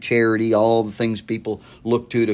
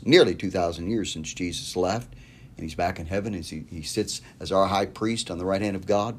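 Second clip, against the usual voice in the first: ordinary delivery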